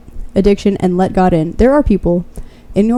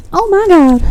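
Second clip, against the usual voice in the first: heated; 8 semitones higher than usual. Real, not acted. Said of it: "Don't mean to get heated there".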